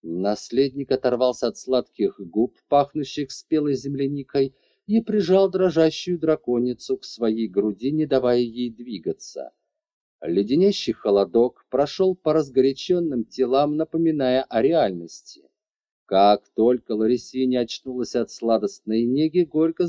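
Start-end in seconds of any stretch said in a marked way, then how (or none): none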